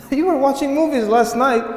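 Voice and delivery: very high, incredulous mock accusation; high-pitched comic reproach; mock indignation. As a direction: tease them in a high, amused protest. Mocking the excuse amid laughter.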